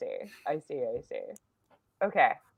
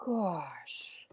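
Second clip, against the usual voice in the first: whispered; 3 semitones lower, 14 dB quieter than usual. Real, not acted. Stunned by clip.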